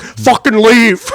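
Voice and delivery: slow, loud, high yell; imitating a buddy yelling at pranksters, drawn out; retold meltdown. Buddy throwing friends out of his work, furious high yell.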